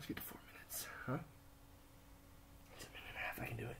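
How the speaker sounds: breathy whisper through effort; strained whisper. Real, not acted. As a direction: whisper to yourself to keep going. Straining whisper mid plank.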